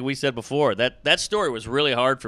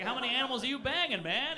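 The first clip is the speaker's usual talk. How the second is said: higher, fast disbelieving question; can't believe what he's hearing; incredulous; 9 semitones higher, 5 dB quieter, faster than usual. Retold or imitated. Comic asks goat guy, incredulous.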